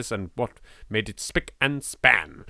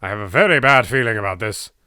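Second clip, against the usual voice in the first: ordinary delivery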